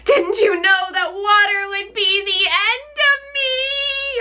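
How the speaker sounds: high, slow, despairing witch wail. Acted. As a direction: wail it out as she melts. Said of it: "in a wailing, despairing voice".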